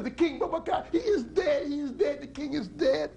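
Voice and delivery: very high, urgent shout; frantic cry. Acted. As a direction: call the crowd in panic. Lady repeats the cry.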